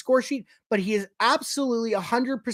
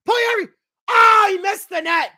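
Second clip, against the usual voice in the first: excited shout; 12 semitones higher, 8 dB louder than usual. Real, not acted. Excited goal chance, live reaction.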